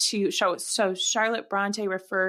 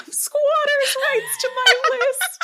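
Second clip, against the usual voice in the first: high laughing disbelief at the claim; 6 dB louder than usual. Real, not acted. Host laughing at her co-host's joke claim. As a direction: laugh it out, incredulous.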